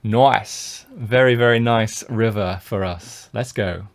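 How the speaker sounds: ordinary talk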